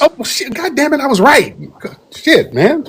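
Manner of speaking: high, laughing burst of triumph; excited surprise. Real, not acted. Host reacting to caller confirming Haiti roots, excited. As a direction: crow at being proven right. Host realizes he guessed right.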